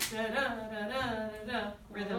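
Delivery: "da" in weak-strong pairs, like a heartbeat; iambic beat. Shown as a demonstration. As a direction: chant "da" in an unstressed-stressed pulse. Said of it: "Iambic meter has this".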